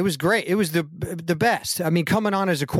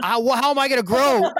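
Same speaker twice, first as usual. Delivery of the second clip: mock disbelief, pitched higher and louder; incredulous; 9 semitones higher, 4 dB louder than usual. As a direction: throw up your hands in mock disbelief. Incredulous retold reply to Mark.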